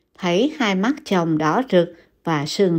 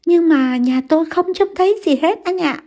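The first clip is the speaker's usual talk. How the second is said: high, pleading insistence; distressed; 9 semitones higher than usual. A narrator's reading. Wife insists to Văn, frightened and near tears. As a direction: press him, frightened and tearful.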